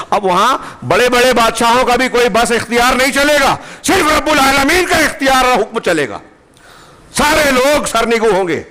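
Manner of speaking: high, ringing sermon proclamation; thundering preaching. Acted. Preacher declaring that only God's command prevails, thundering.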